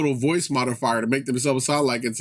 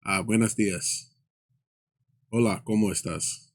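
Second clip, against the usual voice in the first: speaking Spanish; 5 semitones lower, 3 dB quieter than usual. Retold or imitated. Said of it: "talk in Spanish".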